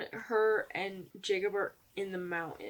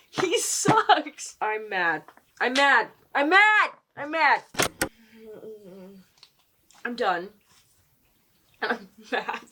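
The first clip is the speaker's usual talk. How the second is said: mad rant; 2 semitones higher, 10 dB louder, slower than usual. Real, not acted. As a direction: spit out rising frustration. Building angry rant at Jacob.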